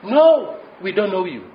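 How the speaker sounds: imitated gatekeeper's emphatic rejection; firm refusal. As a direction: turn the caller away from behind the door. Temple voice refuses the knocker.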